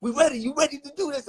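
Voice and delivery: hype man. Retold or imitated. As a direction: pump him up like a hype man. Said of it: "he's got the voice".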